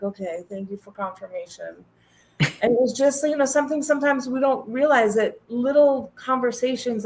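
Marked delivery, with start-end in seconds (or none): 0.0-1.8 much softer, almost to herself; quiet aside. Real, not acted. Taking a kind email as a sign, softly to herself. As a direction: murmur the thanks inward.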